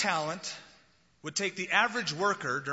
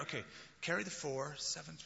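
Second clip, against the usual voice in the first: much softer, counting under the breath; muttered sums; 9 dB quieter than usual. Retold or imitated. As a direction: work it out to yourself like long division. Peter doing sums.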